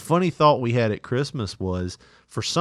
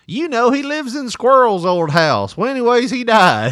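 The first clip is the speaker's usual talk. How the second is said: put-on old mom voice; 11 semitones higher, 6 dB louder than usual. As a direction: chatter like an old small-town mom. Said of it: "I've become the".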